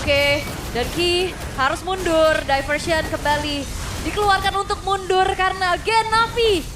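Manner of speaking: loud, fast hype commentary; excited commentary. Real, not acted. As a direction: keep the energy up. Caster keeps hype after fight.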